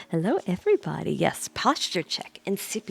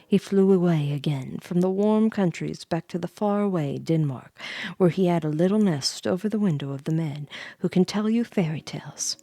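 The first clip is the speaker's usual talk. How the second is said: ordinary delivery, nothing marked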